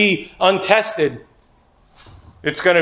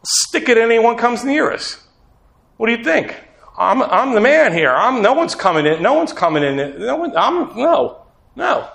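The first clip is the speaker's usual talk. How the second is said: boastful bravado; 5 semitones higher than usual. Retold or imitated. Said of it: "This is the bravado of man".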